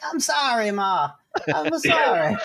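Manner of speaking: mock Italian son. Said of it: "And he's like".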